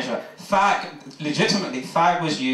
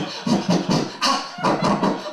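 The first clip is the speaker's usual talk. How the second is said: breathless labour shriek. Acted. Labour scream.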